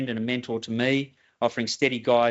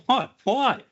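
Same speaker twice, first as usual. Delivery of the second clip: much higher, startled yelp of a question; 11 semitones higher, 4 dB louder than usual. Retold or imitated. Caller replying to the angry project manager, startled. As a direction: act out being baffled, pitch jumping up.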